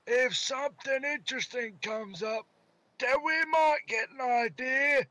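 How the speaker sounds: high, slow Scottish Lily voice; Scottish accent. Pretend. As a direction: push a hammy Scottish brogue. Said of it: "Scottish accent".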